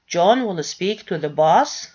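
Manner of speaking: "John will speak to the boss" ending on a clear upward rise on "boss"; rising tone on boss. Shown as a demonstration. Teacher repeats the example for the class, rising on "boss". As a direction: say it as a statement but ask it with the rise on "boss". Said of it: "putting the nucleus stress and the rising tone on".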